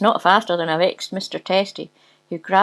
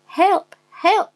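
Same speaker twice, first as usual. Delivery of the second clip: high, loud mock cry for help; lady screaming; 12 semitones higher, 5 dB louder than usual. A narrator's reading. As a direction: call out as a damsel in distress. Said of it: "she screams".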